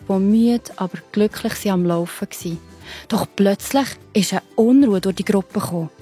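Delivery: ordinary delivery, nothing marked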